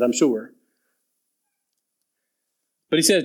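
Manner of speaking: ordinary talk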